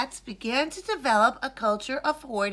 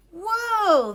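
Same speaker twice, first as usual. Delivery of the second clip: amazed whoa; 9 semitones higher, 6 dB louder than usual. Acted. Ilyas amazed at hoard.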